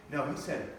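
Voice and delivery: usual speaking voice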